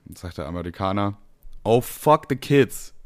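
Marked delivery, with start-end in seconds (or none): 1.6-2.9 American voice. Acted.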